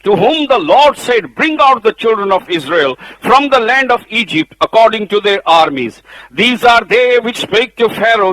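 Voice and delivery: full-voiced sermon delivery; preaching at full force. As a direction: proclaim it like a pulpit preacher. Reading scripture at full force.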